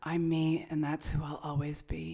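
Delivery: soft breathy whisper of the quote. Retold or imitated. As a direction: whisper it like a mantra. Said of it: "that sounds like Oprah".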